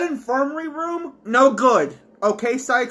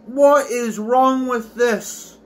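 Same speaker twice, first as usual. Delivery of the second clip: usual speaking voice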